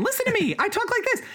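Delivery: ordinary delivery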